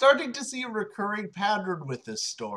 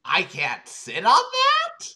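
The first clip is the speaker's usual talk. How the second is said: higher, louder, slowed; high disgusted Naomi; 8 semitones higher, 6 dB louder, slower than usual. Acted. Naomi refusing the candy-covered chair, high and disgusted. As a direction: squeamish protest.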